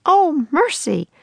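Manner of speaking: high, drawn-out exclamation; crying out. A narrator's reading. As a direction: stretch it out in a high cry. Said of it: "a woman cried".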